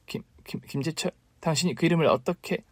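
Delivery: stammering, near-whispered shock; wife caught out, stammering in fear; stunned fear. Acted. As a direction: choke on the name in panic. Wife startled by the name.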